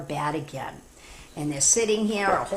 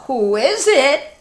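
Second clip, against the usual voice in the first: slow, higher little pig calling through the door; 6 semitones higher, 8 dB louder, slower than usual. Acted. Second pig calls out to the wolf, higher and slow. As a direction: call out as a nervous pig. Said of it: "called the second pig".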